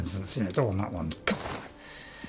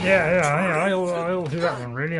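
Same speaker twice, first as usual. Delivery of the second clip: ordinary talk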